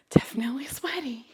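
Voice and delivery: whispered, a little confession; whispered aside. Real, not acted. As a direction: breathe it like a secret. Admitting she is sweating.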